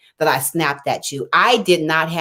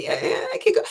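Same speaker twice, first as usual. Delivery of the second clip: mock teen brush-off, much higher and softer; daughter's voice; 5 dB quieter, much faster than usual. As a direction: squeak it out lightly like a shrugging kid. Voicing daughter's casual reply.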